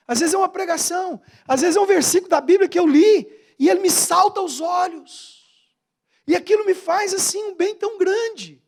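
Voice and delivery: high, swelling testimony; fervent preaching. Real, not acted. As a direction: build intensity with each phrase.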